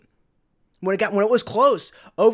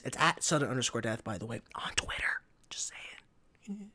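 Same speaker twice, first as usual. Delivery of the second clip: whisper; 8 semitones lower, 10 dB quieter than usual. Real, not acted. Whispered shameless plug aside.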